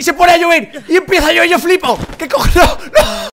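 high, loud disbelieving protest; outraged shouting. Real, not acted. Player climbing as rain starts, outraged shouting. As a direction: yell it in exasperated disbelief.

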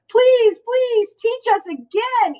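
put-on high, eager kids' please; begging children. A narrator's reading. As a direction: plead with her like excited kids. Children begging Amelia Bedelia.

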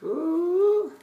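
loud, high-pitched "ooh"; one long whooping ooh, held high. Real, not acted. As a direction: let out a sustained, high "ooh". Exclaims before thanking the viewers.

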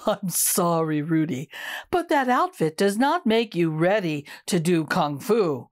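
a hint of a low, gentle elder; lightly voiced elder broccoli. Eldest broccoli turns down Rudy, low and gentle.

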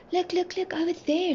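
high-pitched pointing-out cry; mild excited shout. A narrator's reading. Teddy spots something from the train, high mild shout. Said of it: "Teddy shouted".

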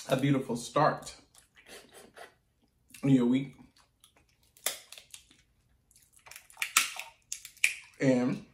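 talking while eating; sucking crab from shell. Real, not acted. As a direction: food at the lips. Seen 1: Handles crab legs, sucks meat from shell at lips mid-speech.

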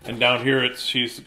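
ordinary delivery, nothing marked

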